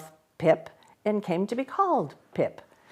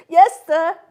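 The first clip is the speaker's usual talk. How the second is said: put-on timid child; 8 semitones higher, 12 dB louder than usual. A narrator's reading. Frightened Pip.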